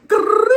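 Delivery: loud, high trilled rattle; imitated gun burst. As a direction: fire off a rolled rifle noise. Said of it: "the little kid was like".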